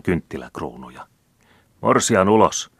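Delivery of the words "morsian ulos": crowd chant, louder and higher; chanting mob shout. A narrator's reading. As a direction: bellow it like a mob outside. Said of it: "huudettiin ulkona".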